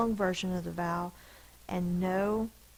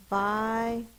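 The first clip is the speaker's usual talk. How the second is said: clear announced word; 3 semitones higher, 5 dB louder than usual. Shown as a demonstration. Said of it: "teacher says word to class".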